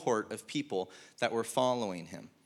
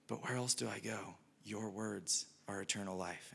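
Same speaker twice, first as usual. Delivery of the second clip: very soft, lowered voice; resigned disciple; 4 semitones lower, 12 dB quieter than usual. Retold or imitated. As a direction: give in quietly, as the acted disciple. Imagined Peter giving in, resigned.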